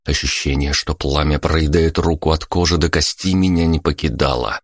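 ordinary delivery, nothing marked

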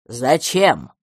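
low, blunt beast question; gruff dinosaur asking why. A narrator's reading. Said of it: "спросил динозавр".

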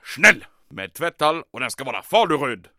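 loud commanding bark. Acted. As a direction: shout the orders at him. Commandant barks demands.

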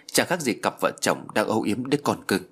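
plain reading voice

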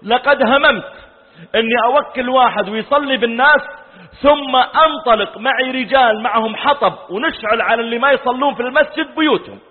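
loud, high, impassioned sermon delivery; fiery preaching. Acted. Preacher warns those who skip mosque prayer, loud and fiery. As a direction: thunder the warning to the congregation. Preaching a hadith at full force.

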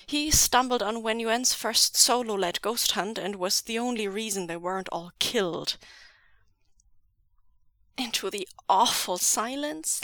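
ordinary delivery

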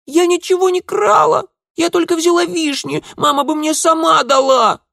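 put-on very high, loud girlish squeal; squealing child. A narrator's reading. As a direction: squeal your defence like a little girl. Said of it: "заверещала девчонка".

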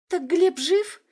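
high, loud and overjoyed; joyful exclamation. A narrator's reading. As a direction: cry out in happy surprise. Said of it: "радостно воскликнула Адашева".

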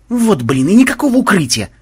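vexed complaint, rising in pitch; peeved gamer griping; annoyed grumble. A narrator's reading. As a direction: grumble in mild exasperation. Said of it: "досадливо буркнул ходок".

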